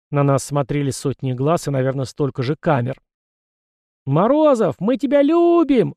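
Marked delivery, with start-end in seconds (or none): 4.0-5.9 high, loud, drawn-out fan scream; woman's shout. A narrator's reading. Female fan in the crowd to Morozov, shouting adoringly. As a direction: call it out high and long over a crowd. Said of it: "раздался женский крик".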